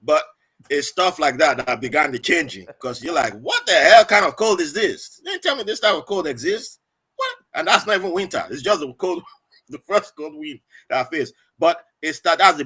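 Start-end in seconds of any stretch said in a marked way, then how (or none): 3.3-7.4 louder, higher, mock-outraged disbelief; shocked complaint. New arrival hit by his first cold wind, mock-outraged disbelief.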